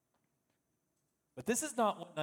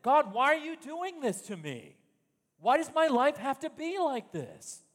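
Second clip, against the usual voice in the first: louder, higher plea of a frustrated believer; complaining to God; 5 semitones higher, 6 dB louder than usual. Acted. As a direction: cry out at God in protest. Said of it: "We come to God and say".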